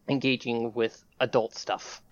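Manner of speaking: usual speaking voice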